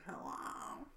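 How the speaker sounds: put-on frail, breathy old woman on the phone; a hushed little old lady hello. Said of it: "really old lady voice".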